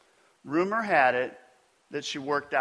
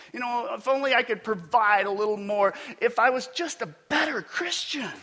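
guilty inner voice; 6 semitones higher than usual. Retold or imitated.

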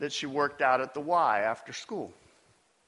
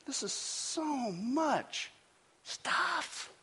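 higher, softer and slow, a sighed complaint; overwhelmed; 10 semitones higher, 8 dB quieter, much slower than usual. Retold or imitated. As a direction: let it sag under the weight. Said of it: "overwhelmed sigh".

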